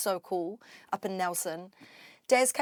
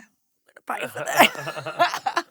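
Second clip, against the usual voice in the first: slow, loud retold groan breaking into laughter; laughing aside; 2 semitones higher, 6 dB louder, slower than usual. Dancer walks away sore, rueful laughing aside. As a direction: drag it out, then crack up.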